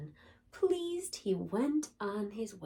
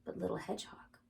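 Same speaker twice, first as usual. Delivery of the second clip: soft, hushed closing line; whispered ending; 7 dB quieter, faster than usual. A narrator's reading. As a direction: let it fade like sleep. Whispered bedtime story ending.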